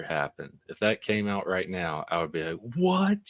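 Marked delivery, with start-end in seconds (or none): 2.8-3.2 sudden high shocked "What?". Pretend. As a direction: squeak it out in disbelief. Shocked reaction to Nessie news.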